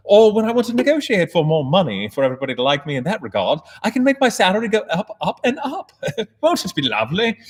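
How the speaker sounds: hammed-up British accent, rising in pitch. Shown as a demonstration. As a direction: switch into a silly posh British accent. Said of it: "switch in between a British accent".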